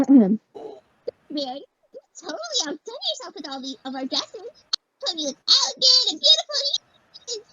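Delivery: high bubbly Pinkie; Pinkie Pie voice. Acted. Pinkie praising Rarity's dresses, high and bubbly. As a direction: bounce up into Pinkie. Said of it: "get back into Pinkie Pie voice".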